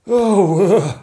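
high, loud startled gasp. Acted. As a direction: catch your breath in alarm. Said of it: "gasped the Lord Chamberlain".

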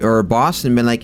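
ordinary talk

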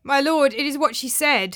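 mildly wretched protest; miserable. A narrator's reading. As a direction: plead helplessly with an angry master. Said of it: "said Andreas miserably".

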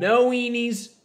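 loud, high mock-stern decree; imagined household rule. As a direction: playfully lay down the law at home. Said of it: "what I imagine Andrew's household sounds like".

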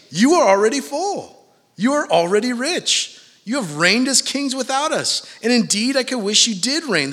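high, loud mock admiration; sarcastic proclamation, pitched well above normal. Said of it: "The Apostle Paul is sarcastic".

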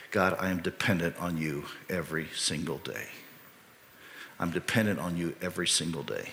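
low, soft, hushed-down prayer; quiet prayer. Acted. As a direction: pray it softly and humbly to God. Voicing a humble private prayer to God.

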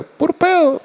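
"Pur pedo" en voz chillona y muy aguda; voz burlona aguda. Retold or imitated. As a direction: lee el documento con burla, mucho más agudo. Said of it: "un documento que dice". (documento del Vaticano, leído con voz de burla aguda)

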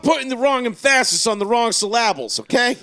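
loud, high exasperated rant; mock scolding. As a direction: scold them with hammed-up exasperation. Mock scolding about mispronounced words.

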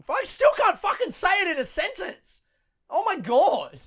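high, loud comic exasperation; exasperated outburst. Real, not acted. Comedian failing to say a word in a sentence, exasperated outburst. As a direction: burst out high and loud at your own failure.

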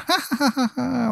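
high, soft evil cackle; evil laugh. Acted. Crazy old man walking away from the stranger, evil laugh. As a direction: cackle evilly as if wandering off. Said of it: "like, laughs evilly".

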